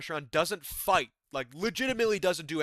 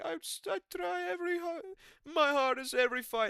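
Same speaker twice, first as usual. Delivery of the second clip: high strained mock fighter voice; squeaky imitation of the tired fighter; quoting the fighter. Fighter answering Rogan, voiced high and strained. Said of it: "And he was like".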